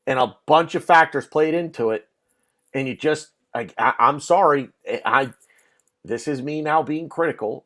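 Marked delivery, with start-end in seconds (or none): none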